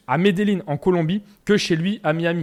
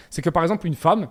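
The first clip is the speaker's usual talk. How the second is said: ordinary talk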